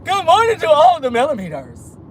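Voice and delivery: squeaky Alf greeting the stream. Pretend. Streamer doing her Alf voice for the chat. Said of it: "Ain't that how Alf sound?".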